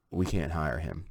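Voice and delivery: low, very soft quoted refusal; hushed retold refusal. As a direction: imitate a manager breaking bad news quietly. Bank manager's reluctant refusal, retold.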